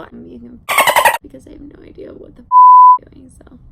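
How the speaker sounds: hushed, self-mocking aside; whispered aside. Real, not acted. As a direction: drop to a whisper. Aside about herself.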